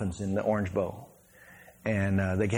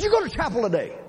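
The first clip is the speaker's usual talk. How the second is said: mock angry snarl; 12 semitones higher than usual. Angry player confronting opponent.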